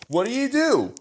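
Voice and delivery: high question with a big swoop up; shocked question. Acted. Patrick asks Jalen what he did, shocked. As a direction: ask it high, demanding to know.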